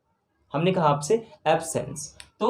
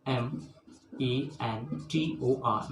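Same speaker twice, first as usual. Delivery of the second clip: very slow soft spelling; 4 semitones lower, 8 dB quieter, much slower than usual. Shown as a demonstration. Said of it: "teacher spells out letters".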